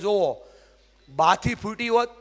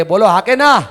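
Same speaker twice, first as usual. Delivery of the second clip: louder call; 9 dB louder than usual. Shown as a demonstration. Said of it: "જોરથી બોલો".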